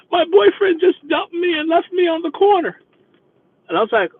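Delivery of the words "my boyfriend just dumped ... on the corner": high, hammed-up tearful whine; mock boo-hooing. Dumped passenger to her driver, mock weeping. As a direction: imitate a weepy young woman, voice pitched high. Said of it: "she is boo-hooing back there".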